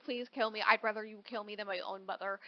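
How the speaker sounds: begging softly to be killed; quiet plea. Retold or imitated. Leah softly begging Gray to kill her instead of her mother, hushed. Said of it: "and she's like".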